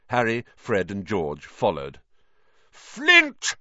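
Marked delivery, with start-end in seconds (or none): 2.7-3.5 high, loud bellow across the pitch. A narrator's reading. Wood bellowing at the Slytherin captain, loud. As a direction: roar the rival captain's name. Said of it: "Wood bellowed".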